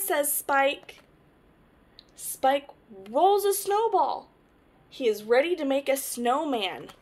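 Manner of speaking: lively picture-book read-aloud; reading to children. Acted. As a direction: tell it to little ones, brightly.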